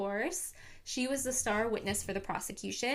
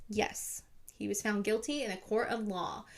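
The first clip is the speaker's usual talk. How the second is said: normal talking voice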